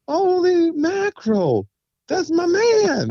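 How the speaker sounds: can't believe it's him, let it out; thrilled disbelief. Retold or imitated. Seeing his old friend walk onstage, high, slow astonished cry. Spotting friend walking on stage.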